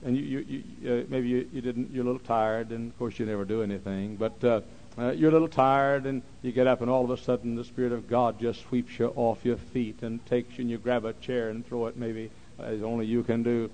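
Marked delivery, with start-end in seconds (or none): none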